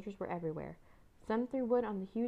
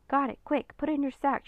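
louder, higher hurried urging; urgent; 6 semitones higher, 6 dB louder than usual. A narrator's reading. Girl charging back with the snatched book, urgent. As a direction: press them to act at once, raised voice.

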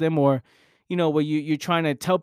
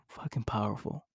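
barely voiced, much softer, almost to himself; hushed awe; 4 semitones lower, 13 dB quieter than usual. Real, not acted. Moved by his own point, he murmurs it softly. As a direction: let it slip out under the breath. Awed aside after a sigh.

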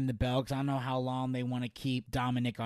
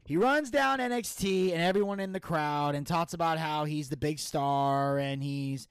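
ordinary talk, nothing marked

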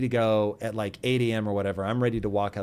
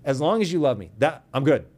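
emphatic louder restatement; 2 semitones higher, 5 dB louder than usual. Retold or imitated. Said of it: "I've said those exact words".

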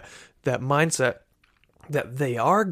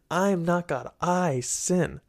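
normal talking voice

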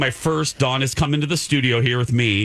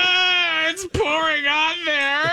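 shrieking in disgust; slower than usual. Real, not acted. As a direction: yelp as the gross sauce pours. Rotten sauce poured onto his bowl.

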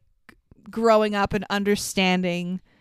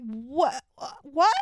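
rising disbelieving what, higher on the second; mock surprise; 4 semitones higher, 3 dB quieter than usual. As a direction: ripetere stupito "What? What?". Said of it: "I'm like".

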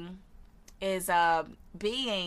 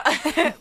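short high nervous chuckle; nervous laugh; 8 semitones higher, 9 dB louder than usual. Real, not acted. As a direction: let out a quick nervous laugh. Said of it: "like, the nervous laugh".

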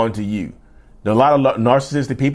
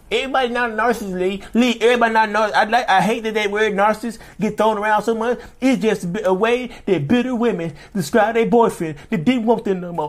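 high whiny mocking voice; mocking critic voice; 10 semitones higher than usual. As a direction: parrot a nagging critic, pitched way up.

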